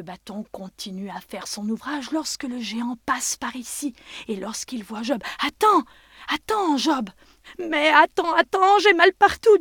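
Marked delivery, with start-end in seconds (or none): 5.4-9.6 pained pleading. Acted. Job in pain calls giant.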